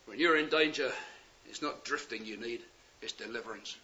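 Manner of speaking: preacher hushing on deliverance; hushed. Real, not acted. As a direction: let it fall to a near hush. Hushed key line of the sermon.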